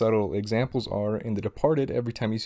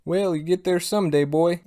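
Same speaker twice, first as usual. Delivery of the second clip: put-on higher-pitched old rich Southerner; southern drawl; 6 semitones higher, 3 dB louder than usual. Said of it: "southern drawl".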